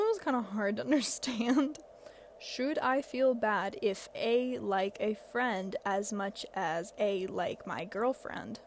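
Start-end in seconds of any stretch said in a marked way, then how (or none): 2.4-8.6 slow, puzzled word-by-word read; slow literal reading. Shown as a demonstration. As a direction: read the typo exactly as written. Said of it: "I'm assuming this person meant to say".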